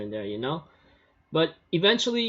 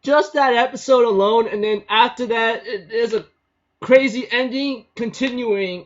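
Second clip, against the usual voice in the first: worked up; 9 semitones higher, 8 dB louder than usual. Real, not acted. Still worked up after screaming.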